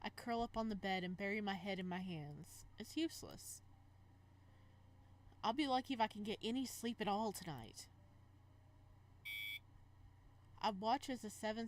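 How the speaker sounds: reading a romance aloud, dreamy; story narration. Acted. Gamer reading a romance game's text aloud, narration. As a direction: narrate the heroine's thoughts with feeling. Reads visual novel aloud.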